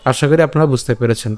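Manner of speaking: usual speaking voice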